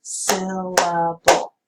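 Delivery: Syllable said slowly, one clap per syllable; clapped syllables. Shown as a demonstration. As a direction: beat out each syllable of Syllable. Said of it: "figure out a word's syllable by clapping".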